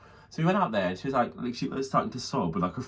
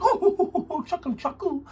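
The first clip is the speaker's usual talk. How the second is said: high-pitched teasing hoot; mock taunting; much slower than usual. Retelling Eric tripping Libby up, high mocking jeer. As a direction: imitate a gleeful heckle, far above usual pitch.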